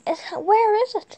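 high, loud frantic question. Real, not acted. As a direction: search in a panic. Frantic search for the house.

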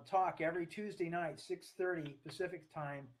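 ordinary delivery

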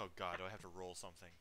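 ordinary delivery

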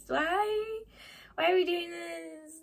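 high, drawn-out mock lament; long wailing plea, hammed-up; mock whining. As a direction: whine it out in exaggerated dismay. Acting out viewer's despair at episodes.

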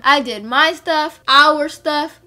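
loud, emphatic protest; retold indignation. Girlfriend arguing over whose stuff she cleaned, acted indignation. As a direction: hit each claim hard, acting out the argument.

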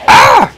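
sudden high shout of surprise; startled yell. Real, not acted. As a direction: jump out of your skin and yell. Startled by enemy appearing.

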